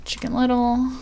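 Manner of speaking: usual speaking voice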